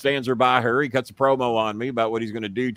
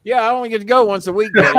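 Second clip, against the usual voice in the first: mock mom; 9 semitones higher, 7 dB louder, faster than usual. Mimicking mom's excuse.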